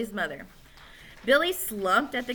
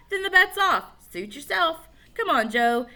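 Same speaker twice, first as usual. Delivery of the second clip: put-on higher, louder kid taunt; high boy voice; 8 semitones higher, 5 dB louder than usual. Alan calling off bet.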